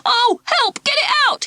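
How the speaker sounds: put-on high, panicked boy's shout. Ralph to the class, shouting in panic as the mouse climbs. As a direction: yelp for help in terror. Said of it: "he shouted".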